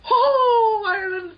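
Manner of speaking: hammed-up Irish wail, high and loud; mock Irish wail. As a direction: wail "Ireland!" like a stage Irishman in grief. Mocking Irish cry.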